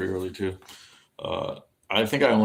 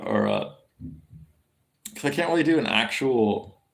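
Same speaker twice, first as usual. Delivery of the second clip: normal talking voice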